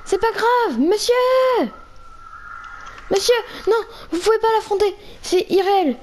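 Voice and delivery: high, urgent panicked calling. Acted. Player fleeing the Enderman, calling his companion in high panic. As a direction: call him along in frantic alarm.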